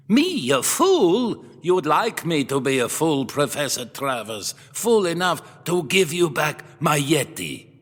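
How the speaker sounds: put-on offended old collector, bristling; angry retort. Museum owner rounding on the professor, indignant Middle European accent. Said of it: "The museum owner marched angrily".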